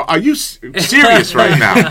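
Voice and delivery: loud, high, drawn-out disbelief; incredulous protest. Retold or imitated. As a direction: stretch it out in outraged mock disbelief. Retold protest at father's critique.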